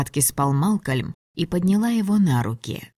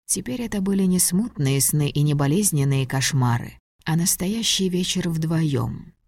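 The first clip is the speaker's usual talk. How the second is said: ordinary delivery, nothing marked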